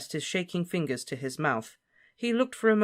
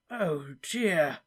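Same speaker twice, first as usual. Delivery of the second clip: breathed; 3 semitones higher than usual. Acted. Slughorn breathing his dismay at Harry's story. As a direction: sigh it out. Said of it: "breathed Slughorn".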